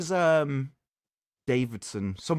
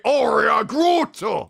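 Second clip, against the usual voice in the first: slow, loud battle cry, higher and drawn out; masculine Groot shout; 7 semitones higher, 7 dB louder, much slower than usual. Retold or imitated. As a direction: bellow the hero's ult line like the game voice. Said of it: "And then when he ults".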